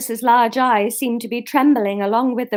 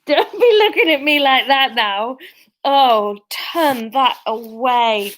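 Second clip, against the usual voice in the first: mock scolding; 3 semitones higher, 5 dB louder than usual. Real, not acted. Scolding the dog.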